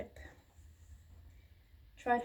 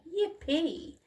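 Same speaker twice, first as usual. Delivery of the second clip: high, louder whoop; gleeful cheer; 7 semitones higher, 4 dB louder than usual. A narrator's reading. Dinosaurs cheering as the tree falls. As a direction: whoop with glee. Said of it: "cried out".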